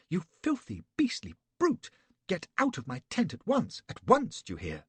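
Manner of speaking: high, loud, scandalised woman; furious lady. A narrator's reading. Betty drives Flashman from her tent, high and furious. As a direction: spit the insults in outrage.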